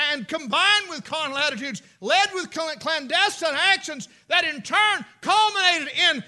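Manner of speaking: loud shouted "calloused arrogance"; full-force preaching. Real, not acted. Preacher thunders about calloused arrogance. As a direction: build to a roaring climax.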